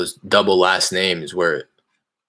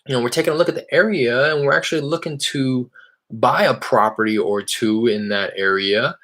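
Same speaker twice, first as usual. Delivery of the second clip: bright, higher salesman voice on a cold call; cold-call voice, higher and eager; upbeat phone pitch; 5 semitones higher than usual. Real, not acted. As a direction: sound friendly and casual to a stranger.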